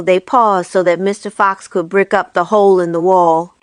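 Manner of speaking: ordinary delivery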